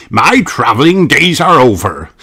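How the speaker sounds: put-on proud old sailor; lightly voiced sea captain. A narrator's reading. Captain Dog telling family he is home for good, hearty voice. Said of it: "replies Captain Dog".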